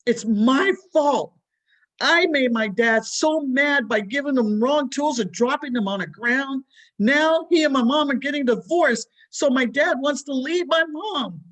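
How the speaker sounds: high, loud, sobbing out the blame; loud tearful cry of a child who blames himself; crying hard. A narrator's reading. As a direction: wail it like a heartbroken boy. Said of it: "Gianni began crying so hard".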